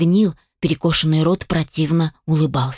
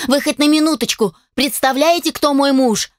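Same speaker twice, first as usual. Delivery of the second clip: higher-pitched indignant demand; angry growled challenge; 6 semitones higher than usual. A narrator's reading. Captive wife threatening her kidnapper with her husband, angry. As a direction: confront your captor, angrily. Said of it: "зарычала на него Сара".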